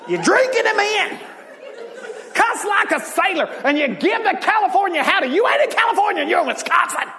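mock scolding shout at a new believer; mock condemning preacher. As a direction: play the judgmental church elder yelling at a sinner, half laughing. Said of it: "they get told what they're doing is a sin".

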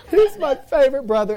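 loud, high hammed-up wail; mock crying. Sister crying over her bleeding brother, mock wailing. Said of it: "and they're crying".

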